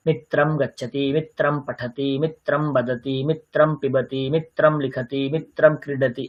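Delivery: steady, monotone chant-like rhythm; sentence-drill recitation. Shown as a demonstration. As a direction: recite each pair evenly on one pitch.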